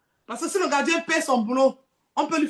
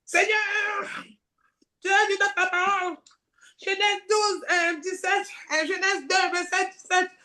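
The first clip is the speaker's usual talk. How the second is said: higher, pleading prayer voice; 7 semitones higher, slower than usual. Acted. Abandoned woman praying to God, high and pleading. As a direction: cry out to the Lord.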